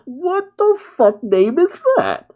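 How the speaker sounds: high-pitched mock indignation; incredulous protest. Kid objecting to the alliance's name, incredulous protest. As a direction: squeal it in comic disbelief. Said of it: "He's just like".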